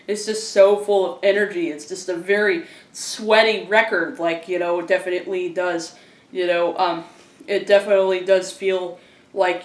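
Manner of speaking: ordinary talk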